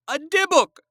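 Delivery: put-on high, cracked old-woman cry; frightened old woman crying out. A narrator's reading. Old woman seeing the trunk, high frightened cry. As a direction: shriek like a terrified old lady. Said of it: "she cried".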